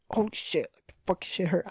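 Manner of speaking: high, soft mock cursing in pain; re-enacted pained reaction. Speaker retelling hurting a hand making the bed, high, soft pained cursing. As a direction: replay a pained reaction, high and under your breath.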